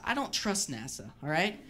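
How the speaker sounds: ordinary talk, nothing marked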